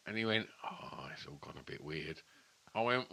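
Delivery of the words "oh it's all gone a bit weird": hushed whisper of bad news. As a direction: whisper it like a secret. Retold phone call, bad news.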